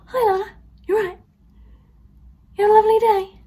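high, fast, loud gushing greeting; delivery driver hamming up a cheery hello; over-cheery driver. Retold or imitated. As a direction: gush like an overexcited fan. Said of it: "but that was very dramatic".

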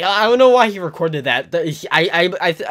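high, loud flustered burst; flustered outburst. Real, not acted. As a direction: blurt it out in disbelief. Laughing embarrassed at memory.

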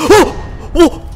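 short, loud, high gasped yelps; startled cries. Acted. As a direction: jump and yelp in shock. Startled by a figure on roof.

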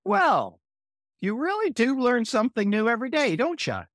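high, hurried, over-bright brush-off; embarrassed cover-up. Acted. Pete caught mispronouncing a word, high embarrassed cover-up.

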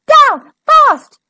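loud, high one-word commands; punchy, high-pitched shouts; urgent yell. A narrator's reading. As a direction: shout orders in a panic. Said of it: "he yelled".

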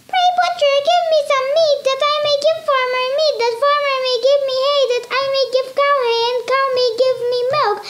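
high, squeaky pleading mouse; a tiny mouse begging, speeding up; little mouse voice. Acted.

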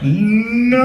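high alarmed no; handlers crying no. Retold or imitated. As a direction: yell it to stop her. Handlers cry out.

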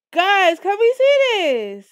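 stunned and loud; excited disbelief. Real, not acted. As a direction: call the viewers over in shock. Shocked by in-game money.

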